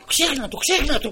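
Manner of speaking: high, loud hurried plea; urgent pleading. Retold or imitated. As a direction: beg her to drop it. Official begs her to forget it.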